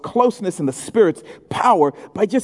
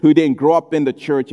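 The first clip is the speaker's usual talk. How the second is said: ordinary delivery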